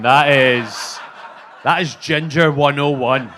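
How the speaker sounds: loud, drawn-out exclamation; loud, emphatic self-reproach; exasperated realisation. Acted. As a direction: belt it out as the blindingly obvious.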